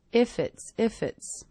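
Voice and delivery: "If it's" joined, F gliding into "it's"; linked words. Shown as a demonstration. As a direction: run "If it's" together as one. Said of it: "connecting together".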